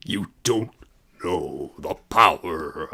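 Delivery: very low, slow villain impression; Darth Vader. Embarrassed streamer attempting a villain impression on request, low and slow. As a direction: drop really low and draw out the words. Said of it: "it's not gonna sound anything like Darth Vader".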